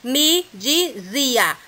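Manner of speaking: "Me dizia" stretched out slowly, leaning on "zi"; slow syllable count. Shown as a demonstration. Teacher stretching the word to show the stressed syllable, very slow. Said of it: "A gente conta só até o zi".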